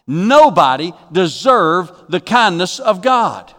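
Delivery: slow, weighty stress on "Nobody deserved the kindness of God"; emphatic. Shown as a demonstration. Preacher driving home that "Nobody" deserved God's kindness, slow and emphatic. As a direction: draw out "Nobody" and land each word emphatically. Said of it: "I want to make that emphatic".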